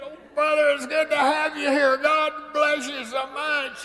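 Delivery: chair-bound greeter. Retold or imitated. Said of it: "He reaches around and he says".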